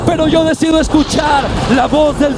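clamor de predicador, muy alto y agudo; prédica a gritos. Real, not acted. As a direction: alarga las palabras y grita la prédica con toda tu fuerza. Clímax de la prédica. (predicación encendida en su clímax)

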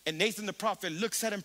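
ordinary talk, nothing marked

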